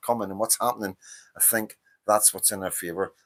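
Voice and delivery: normal talking voice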